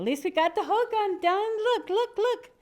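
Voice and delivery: high-pitched eager urging; excited bird. Acted. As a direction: urge them to look, high and excited. Bird shows off finished hogan.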